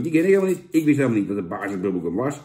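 ordinary talk, nothing marked

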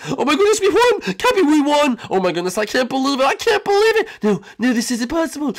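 high, loud disbelieving cheers; overjoyed winner. Acted. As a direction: scream with joy at winning. Mario celebrating the victory.

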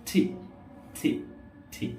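"ঠি" with a strong puff after the t; separate, aspirated "ঠি" each time. Shown as a demonstration. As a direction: say "ঠি" as a breathy "thee" with tongue-stop t. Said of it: "টি বা ট না বলে আমরা বলতে হবে".

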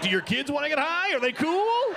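high, hopeful mock-eager question; eager asking. Man to friends who have kids, eager asking. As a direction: pitch climbs with comic eagerness.

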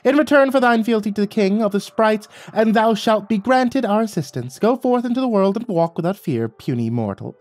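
pompous high-pitched royal decree; sprite king calling out. A narrator's reading. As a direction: announce it grandly like a tiny town crier. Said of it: "cleared his throat and called out".

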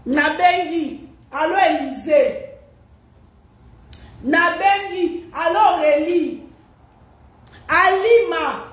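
loud, slow calling. Real, not acted. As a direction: call out the names with emphasis. Calling out names on the phone. (appels forts et lents)

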